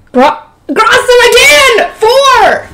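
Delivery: high, drawn-out disbelief; shocked exclaim. Real, not acted. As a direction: cry out in real disbelief. Reader reacts to yet another Grossum.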